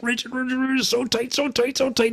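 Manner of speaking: high, squeezed and softened; straining tightness. Retold or imitated. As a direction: imitate something inflated to bursting. Imitates pool toy inflating to bursting.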